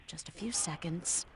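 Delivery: breathless urgent whisper. Pretend. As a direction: whisper it so no one hears. Hacker whispers during download.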